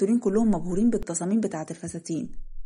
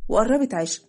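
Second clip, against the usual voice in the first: ordinary delivery, nothing marked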